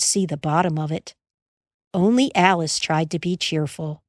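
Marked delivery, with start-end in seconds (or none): none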